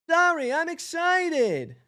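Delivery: high, slow, squeaky excited bee; Barry repeat, high. Acted. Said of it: "repeat in higher voice".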